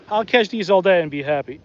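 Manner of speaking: usual speaking voice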